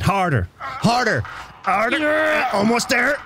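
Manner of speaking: building to a high, straining peak; mock climax. Mock innuendo climax while pulling.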